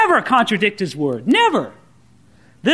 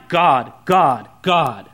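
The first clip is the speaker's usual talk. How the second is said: low, evenly spaced repeated word; flashing sign; 8 semitones lower, much slower than usual. Acted. Pastor mocking Peter's supposed revelation, flashing sign voice. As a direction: intone it low, like a sign blinking. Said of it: "it was flashing in his mind".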